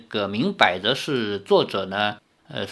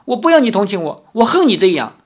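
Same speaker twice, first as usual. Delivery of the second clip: louder and higher, fast tearful protest; tearful outburst; 9 semitones higher, 8 dB louder, faster than usual. Acted. Lin Yueru, weeping at the table, rejects his pity in a loud tearful outburst. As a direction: blurt it out through tears. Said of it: "林月如趴在桌上哭了起来".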